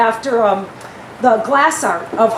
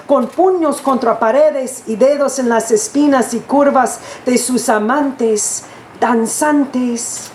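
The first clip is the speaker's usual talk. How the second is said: poetry reading; 4 semitones higher than usual. Acted. Recites Spanish poem to drummers.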